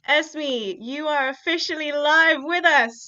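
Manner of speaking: loud, high announcer call; announcing. Real, not acted. Host welcoming the first poet to the stage, loud announcer call. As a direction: bring the guest on with a big call. Host calling guest onstage.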